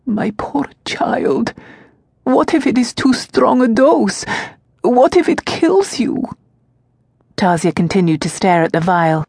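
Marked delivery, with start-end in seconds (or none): 0.1-6.2 panicked pleading, pitch soaring; frightened old servant. Acted. Servant Varka fearing the dose will kill Tasia. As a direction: voice climbing much higher with fear.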